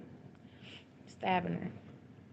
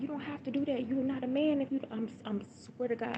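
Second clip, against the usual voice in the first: insistent, higher-pitched plea; retold pleading; 7 semitones higher than usual. Mother begging a man not to kill her daughter, pleading.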